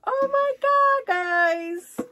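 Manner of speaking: high, loud delighted squeal; thrilled gasp over a find; excited squeal. Real, not acted.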